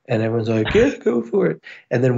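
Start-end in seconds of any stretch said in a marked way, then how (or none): 0.7-1.6 egging on. Retold or imitated. Crowd cheering him on.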